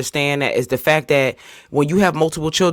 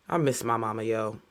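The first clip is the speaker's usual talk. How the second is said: much softer, lower, sinking; quiet grief; 6 semitones lower, 11 dB quieter than usual. Real, not acted. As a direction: let the missing her drain you quiet. Grief after long plea.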